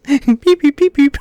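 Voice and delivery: high electronic beeping; R2-D2 beeps. Retold or imitated. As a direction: beep it out like a droid. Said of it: "R two D two died".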